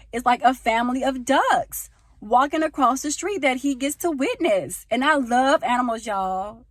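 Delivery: high, loud, stretched-out excitement; real passion, voice climbing high and loud; getting loud with passion. Real, not acted. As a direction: gush loudly, drawing out the key words. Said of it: "I'm getting so loud right now".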